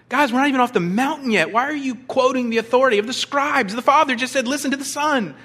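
high, loud, fast exasperated rebuke; exasperated Jesus. Retold or imitated. Jesus scolding disciples on the mountain, exasperated high rebuke.

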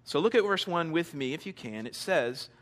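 ordinary delivery

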